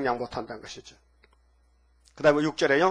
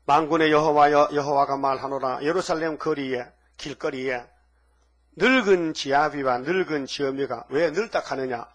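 slow, loud, weighty recitation of the verse; solemn scripture reading; 4 dB louder than usual. Acted. Preacher reading Zechariah aloud to the congregation, solemn and slow. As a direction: proclaim the prophet's words slowly and loud.

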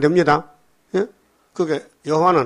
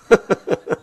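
real amused chuckle; higher-pitched laugh at own joke; 5 semitones higher than usual. Real, not acted. Preacher landing the Jeolla bibimbap joke, laughing.

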